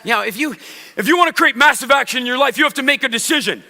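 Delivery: high, loud, fast and driving; fast loud visual speaker. Shown as a demonstration. Demoing a visual speaker to the audience, loud fast hype. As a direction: pump them up like a motivational guru. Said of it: "and they speak like this".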